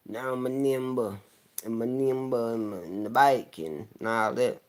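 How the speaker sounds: mock a rapper talking nonsense in interviews. Critic mocking a rapper's TV interview talk, exaggerated loud imitation. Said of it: "you know how [name] get on".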